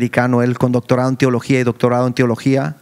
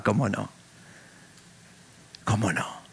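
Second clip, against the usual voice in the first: sarcastic aside; 6 semitones lower, 12 dB quieter than usual. Retold or imitated. Son doubting father's prophecy.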